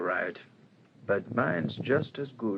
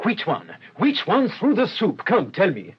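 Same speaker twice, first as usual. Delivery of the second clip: higher, louder, pressing for the answer; urgent questioning; 8 semitones higher, 5 dB louder than usual. Acted. Detective urgently pressing the proprietor, excited demand. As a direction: demand it fast, keen on the trail.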